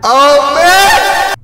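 rising excitement. Real, not acted. New game starts, hyped.

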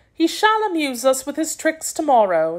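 yüksek perdeden prenses sesi. A narrator's reading. Princess's dialogue in story.

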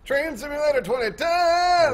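high, loud, drawn-out holler; slow, hammed-up announcer yell; mock title announcement. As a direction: shout the title like a hype man.

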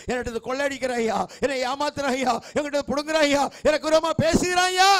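rapid plea rising to a loud cry; preacher crying out to God, building to a shout; building acted complaint.

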